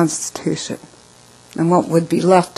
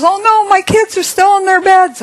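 very high, loud mock wail; high-pitched retold cry of alarm; imitated panic; 5 dB louder than usual. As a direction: act out a panicked mother, pitch way up. Acts out panicked mother in fire.

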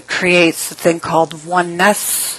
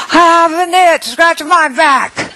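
higher, louder, slow demand; insistent old woman; 10 semitones higher, 4 dB louder, slower than usual. Retold or imitated. Elderly woman insists the narrator scratch her back, slow and higher. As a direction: drag out the order stubbornly.

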